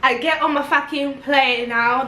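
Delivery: normal talking voice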